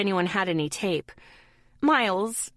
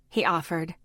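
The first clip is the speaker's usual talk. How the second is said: usual speaking voice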